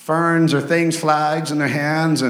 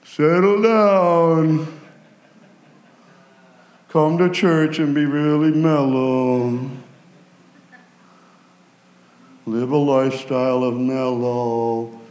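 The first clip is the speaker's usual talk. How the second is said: slow, low, sleepy mockery; mock mellow voice; 3 semitones lower, slower than usual. Preacher mocks telling praisers to be mellow, slow low drawl. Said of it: "You think you're gonna say".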